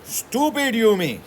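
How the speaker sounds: high, loud and slow with scorn; fierce scolding. A narrator's reading. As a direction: spit out the name in contempt. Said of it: "She muttered fiercely".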